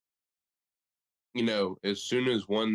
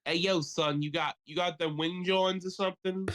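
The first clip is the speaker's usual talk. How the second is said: put-on Philly accent; 7 semitones higher than usual. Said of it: "nobody was doing a Philly accent".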